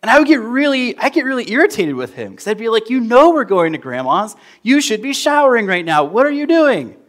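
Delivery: mock-whiny child, pitched well up; irritated kid. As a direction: play the impatient little boy nagging his dad. Child annoyed at dad, retold.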